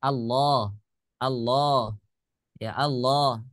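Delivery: "Allah" with the la held two counts; long la in Allah. Shown as a demonstration. Teacher repeating Allah to show the long vowel, measured. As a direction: stretch the la of "Allah" evenly. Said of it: "kalimat Allah pasti kita baca mat tabi".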